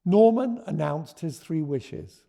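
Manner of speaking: ordinary delivery, nothing marked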